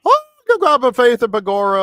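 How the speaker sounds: loud, high, hammed-up Irish accent; mock Irish accent. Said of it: "if you're doing an Irish accent".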